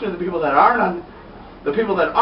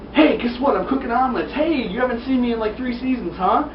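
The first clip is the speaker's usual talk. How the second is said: higher-pitched imitation of an eager cook; mock chirpy greeting; 7 semitones higher than usual. Omelet cook greets long-unseen viewer, mock eager. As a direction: greet an old friend in a put-on high voice.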